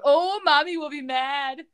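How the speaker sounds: a hammed-up kid voice fretting about mommy; childish whine. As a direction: say it like a scared little kid. Joke line written for the character.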